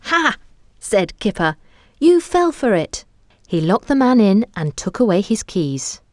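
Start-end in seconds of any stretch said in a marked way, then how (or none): 0.0-3.1 put-on triumphant child voice. Kipper tricks the guard.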